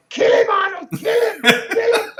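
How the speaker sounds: high-pitched frantic cheering shout; retold yelling. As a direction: yell it out, urging the fighter on from the sidelines. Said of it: "Franco's yelling out".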